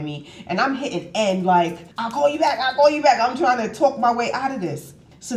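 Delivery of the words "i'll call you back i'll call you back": high, louder repeated promise; retold frantic phone dismissal; harried brush-off. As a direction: act out fobbing off a caller. Hurried brush-off to caller, retold.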